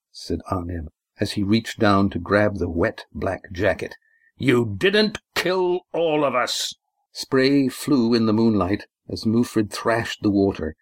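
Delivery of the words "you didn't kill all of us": slow, high-pitched defiant shout, held back in volume; acted struggle, words stretched and raised; defiant cry. A narrator's reading. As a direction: draw out each word in strained defiance. Confronting attacker in a sea fight.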